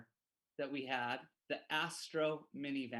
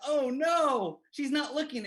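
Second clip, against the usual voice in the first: high, loud panicked oh no; boy realising she won't turn, high alarmed cry; dismayed oh no; 8 semitones higher, 6 dB louder than usual. Retold or imitated. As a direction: blurt it out in sudden dismay. Boy panics mid-run, retold.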